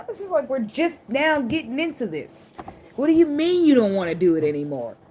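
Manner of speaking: high, hammed-up clinging partner; mock disbelieving protest. Reader voicing a partner being left, high mock-incredulous protest.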